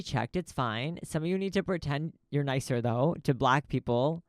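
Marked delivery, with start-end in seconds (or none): none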